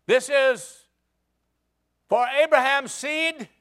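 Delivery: loud, high, declaring call; young David yelling his vow at the Jebusites; defiant shout. Acted. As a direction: throw the threat up the wall.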